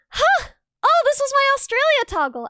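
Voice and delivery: light up at a rediscovery; surprised gasp. Real, not acted. Streamer gasping at her old Australia toggle.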